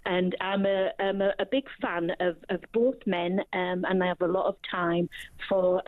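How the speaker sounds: normal talking voice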